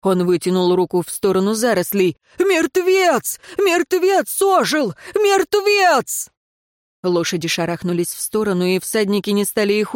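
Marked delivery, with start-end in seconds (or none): 2.4-6.3 high-pitched, drawn-out cries of alarm; panicked wailing. A narrator's reading. Tracker points at the undergrowth, panicked wailing. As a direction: wail in superstitious panic. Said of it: "заголосил следопыт".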